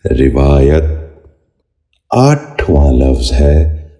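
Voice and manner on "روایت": روایت said "rivaayat", short "i" after the r; correct pronunciation. Shown as a demonstration. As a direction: open روایت with "ri", then long "vaa". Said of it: "آپ بھی غور سے سنیے".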